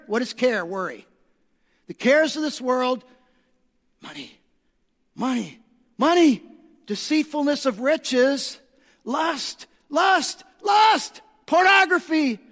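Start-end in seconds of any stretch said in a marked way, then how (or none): 1.8-8.6 loud, high, fervent preacher's call; full-force preaching. Acted. 9.1-11.2 each lust louder and higher, ending in a shout; building shout. Real, not acted. Repeated word rising to shout.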